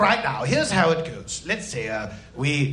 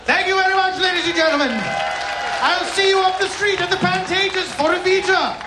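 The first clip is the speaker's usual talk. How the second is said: curtain call shout; 8 semitones higher than usual. Acted. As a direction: bellow it to the whole house. Curtain-call announcement to audience.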